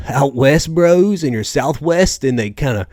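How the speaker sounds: mock Southwest bro accent. Shown as a demonstration. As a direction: draw it out like an out-west bro. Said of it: "they kinda talk like this".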